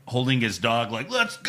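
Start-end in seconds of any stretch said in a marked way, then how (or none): 1.1-1.5 high-pitched drawn-out shout; mock battle cry. Wrestler cheering on his dog, drawn-out mock shout. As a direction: holler it like a hype man.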